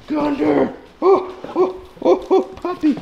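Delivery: very high, excited puppy voice. Real, not acted. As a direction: squeal greetings up high at the dog. Calming the jumping dog.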